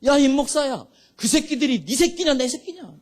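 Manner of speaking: high, insistent divine challenge; God's thundering rebuke. Retold or imitated. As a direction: call him out sternly from heaven. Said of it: "또 똑같은 소리로".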